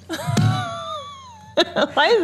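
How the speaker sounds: high laughing squeal; breaking up laughing. Real, not acted. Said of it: "laughing while trying".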